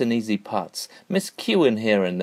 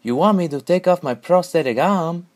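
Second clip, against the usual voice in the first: short chump; 8 semitones higher, 4 dB louder than usual. Acted. Short chump answers, higher voice.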